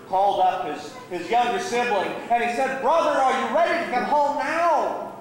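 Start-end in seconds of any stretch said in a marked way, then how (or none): 2.8-5.1 high-pitched, pleading call; fervent appeal. Acted. Older brother phoning once more, pleading fervently. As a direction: call him home with urgency.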